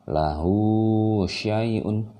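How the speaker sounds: "Lahu" with hu held long, then "syai'un"; long vowel. Shown as a demonstration. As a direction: recite slowly, stretching the end of "Lahu". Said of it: "jadi hanya harus panjangkan". ("Lahu" dengan hu dipanjangkan)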